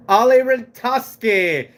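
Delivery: Ali Ratoski said slowly, syllable by syllable; slow careful name. Shown as a demonstration. Vlogger sounding out a viewer's name, slow and careful. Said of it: "let's try to say it right".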